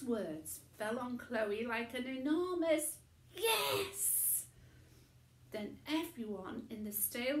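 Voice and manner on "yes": high, joyful little cry; a burst of glee; excited yes. A narrator's reading. Girl cheering inside at the tramp's praise, high.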